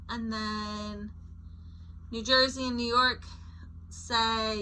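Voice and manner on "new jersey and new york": ordinary delivery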